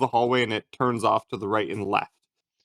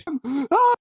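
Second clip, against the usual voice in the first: very high-pitched goblin shrieks; panicking goblins. Acted. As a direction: squeal in comic panic as a little goblin. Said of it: "the goblins are panicking".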